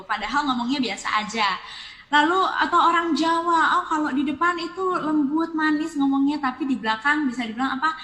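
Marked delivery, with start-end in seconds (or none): none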